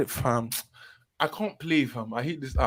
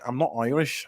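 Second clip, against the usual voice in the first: badly faked Irish accent, softer; 5 semitones lower, 9 dB quieter than usual. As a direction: put on a terrible Irish accent on "Irish". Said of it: "that was the worst Irish accent".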